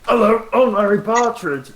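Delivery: high cartoon character voice; Gary Larry voice. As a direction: put on a squeaky cartoon intro. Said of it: "Gary Larry is more like this".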